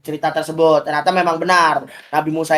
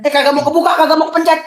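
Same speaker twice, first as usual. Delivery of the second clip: mock fluster; 10 semitones higher, 3 dB louder, faster than usual. Teacher fumbling with teased phone.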